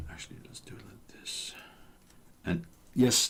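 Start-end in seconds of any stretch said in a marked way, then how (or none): 0.1-1.5 muttered whisper to self. Real, not acted. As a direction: whisper it aside. Whispering to himself while working.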